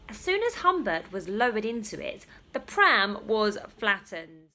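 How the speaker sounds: ordinary delivery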